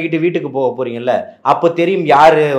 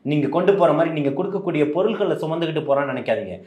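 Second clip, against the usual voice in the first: usual speaking voice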